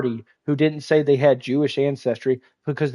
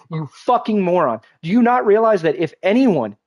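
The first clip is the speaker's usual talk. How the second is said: ordinary talk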